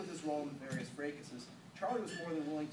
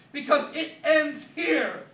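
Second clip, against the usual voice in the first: much louder and higher, slow and grand; dramatic vow; 12 semitones higher, 17 dB louder, much slower than usual. Acted. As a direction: proclaim it like a final showdown.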